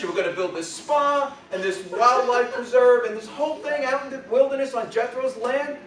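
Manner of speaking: high, loud outraged Yiddish mother; Yiddish accent. Acted. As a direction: fret about the neighbours, aghast.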